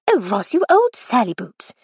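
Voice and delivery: a mild playful scolding; chiding. A narrator's reading. Friend gently chiding grumpy old classmate, mild reproach. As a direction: tut at her friend, lightly teasing. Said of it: "chided Miss Titmuss".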